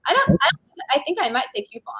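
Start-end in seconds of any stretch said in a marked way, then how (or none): 1.7-1.9 coupon said as "CUE-pon"; Q sound in coupon. Shown as a demonstration. Answering how they might say coupon, CUE-pon. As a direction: start coupon like the letter Q. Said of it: "with, like, a Q".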